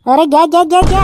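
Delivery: alarmed cry. Acted. Bystander watching a vehicle go into floodwater, alarmed.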